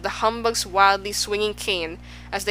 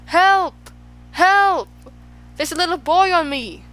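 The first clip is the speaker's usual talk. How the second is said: very high, loud cries; shouting for help; 9 semitones higher, 6 dB louder than usual. A narrator's reading. Bee pinned under Milo, shouting for help. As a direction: panicky yell for rescue. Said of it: "and lay there shouting".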